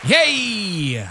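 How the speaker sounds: high, loud drawn-out "yay"; loud cheer. Real, not acted. Host opening his podcast, loud cheer. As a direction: cheer it out high, falling away. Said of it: "that was a little loud".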